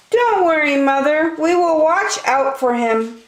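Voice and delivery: eager little ones reassuring mother; little wolves. Acted. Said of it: "said the three little wolves".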